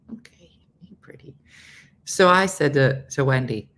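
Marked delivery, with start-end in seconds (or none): 0.1-1.4 soft whispered praise; whisper to pet. Real, not acted. Talking to her pet.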